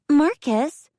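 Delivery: high, loud scolding call. A narrator's reading. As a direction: snap his name to stop him. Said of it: "a breathless girl chastised".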